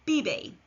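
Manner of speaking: "bibe" with b sounds, falling; model Spanish verb. Shown as a demonstration. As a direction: say "bibe" clearly for learners. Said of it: "Repeat after me".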